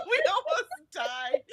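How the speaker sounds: high, slow, laughing it out. Real, not acted. Host laughing at her orc story, slow and high. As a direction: let the laugh carry the words.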